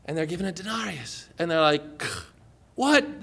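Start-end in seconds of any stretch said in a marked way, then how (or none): 2.8-3.2 high-pitched, incredulous question; appalled. Acted. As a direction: react in acted dismay. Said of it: "They are appalled".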